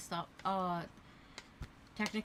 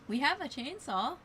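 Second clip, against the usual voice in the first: excited; 9 semitones higher, 6 dB louder than usual. Real, not acted. Excited joke about the chainsaw.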